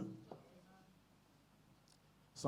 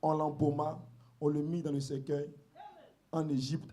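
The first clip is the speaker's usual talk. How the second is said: scripture reading; 3 semitones lower, slower than usual. Acted. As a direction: read the verses slowly and reverently. Reads scripture aloud.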